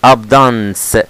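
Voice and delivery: ABUNDANCE cut down to AB-dans; wrong pronunciation. Shown as a demonstration. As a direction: say "abundance" without its "un" syllable. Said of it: "عشان في ناس بتنطقها".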